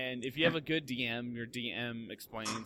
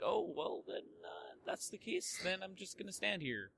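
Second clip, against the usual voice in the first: very high, small put-on voice; imitating a helpless player, pitched way up; mock resignation; 8 semitones higher, 5 dB quieter than usual. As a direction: give up in a squeaky, softer voice.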